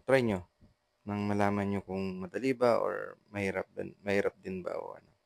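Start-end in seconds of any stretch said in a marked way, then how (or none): none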